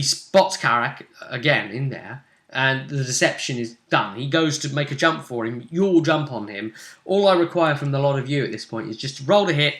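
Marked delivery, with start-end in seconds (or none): none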